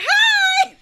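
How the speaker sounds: very high, loud squealed hi. Retold or imitated. Fan arriving imitates her own loud squealed greeting. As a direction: burst in with a huge greeting. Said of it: "I'm loud as hell".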